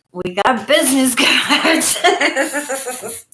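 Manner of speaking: slow, laughing through the words. Real, not acted. Telling viewers about business cards, laughing. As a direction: giggle as you say it.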